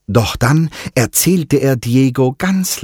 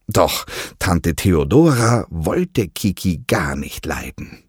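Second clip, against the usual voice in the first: usual speaking voice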